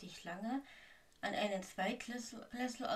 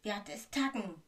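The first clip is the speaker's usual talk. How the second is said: raised-voice incantation; loud spell-casting cry; called-out spell; 7 dB louder than usual. A narrator's reading. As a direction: call the spell out firmly and loud. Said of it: "rief Harry".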